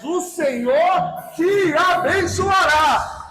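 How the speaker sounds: slow, drawn-out shouted proclamation; full-force preaching. Real, not acted. Preacher promising God's blessing to the congregation, slow shouted proclamation.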